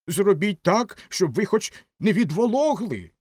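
loud, high-pitched urging; high emphatic shout; crying out. A narrator's reading. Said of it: "крикнула римська свічка".

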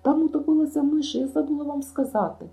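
loud, high-pitched frantic outcry; panicked shriek. Acted. Anne leaps up from the table to stop Marilla, shrieking. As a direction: shriek it out to stop her serving. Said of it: "підхопилася за столу і заверещала".

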